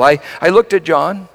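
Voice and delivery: ordinary talk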